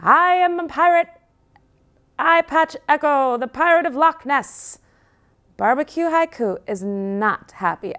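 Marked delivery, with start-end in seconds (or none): 0.0-4.8 high, loud swaggering pirate boast; playful pirate. Acted. Puppy on the mast declaring himself a pirate, high and loud. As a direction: boast like a pup playing pirate.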